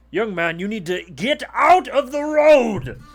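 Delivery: high, loud guard's order, building to a bellow; authoritative shout. Acted. Guard orders a young man off the road, authoritative shout. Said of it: "you hear a voice of authority".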